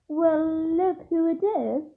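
slow, lazy sneering drawl; a lightly voiced sneering Malfoy. A narrator's reading. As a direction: drawl it out lazily, looking down on them. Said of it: "said Malfoy in his usually lazy drawl".

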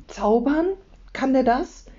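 high, hopeful questions; eagerly curious. A narrator's reading. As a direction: suggest it with excitement, voice lifted. Leo eagerly guesses magic powers.

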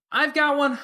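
high, loud call across the room. A narrator's reading. Verona calls to the room with a loud high call. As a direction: call it out over the party noise. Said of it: "Verona called out".

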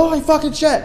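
high-pitched mock-panicked cry; freaked out. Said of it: "we all freaked out".

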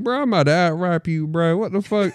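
high, incredulous protest; mock challenge. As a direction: act out an outraged fan's boast. Acting out rivalry with another fan.

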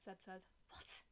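ordinary delivery, nothing marked

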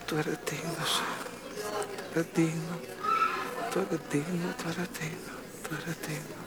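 soft, near-whispered adoration; hushed worship. Acted. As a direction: murmur it softly, lost in worship. Quiet worship over music. (adoración susurrada y suave)

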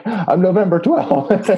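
high, laughing retold correction; laughing protest. Woman correcting her friend about her birthday, laughing. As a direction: say it through giggles, drawn out.

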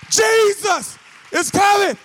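high, loud, slow shout; shouted proclamation. Real, not acted. Preacher shouting the message to his congregation. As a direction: shout it out to the church. Climax of sermon.

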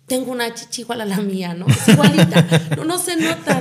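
bursting with happy laughter; laughing with joy. Retold or imitated. Telling her sister on the phone about the new prosthesis, laughing.

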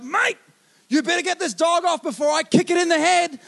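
loud, high threat at the owner; angry shout. Retold or imitated. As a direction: bellow a warning to the dog's owner.

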